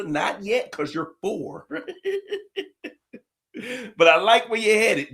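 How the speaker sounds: ordinary talk, nothing marked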